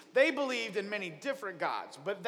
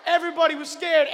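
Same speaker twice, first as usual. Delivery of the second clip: loud, higher, dramatic storytelling; dramatic scared; 6 semitones higher, 7 dB louder than usual. A narrator's reading. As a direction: sell the fear to the kids with a big voice. Dramatic storm retelling.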